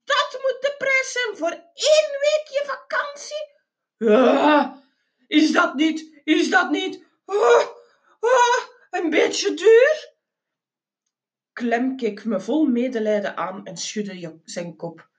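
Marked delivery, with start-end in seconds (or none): 0.0-10.1 high wailing disbelief with gasps; hammed-up breathless, tearful panic; horrified shock. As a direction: squeal and stammer in horror at the price. Horrified at the astronomical price.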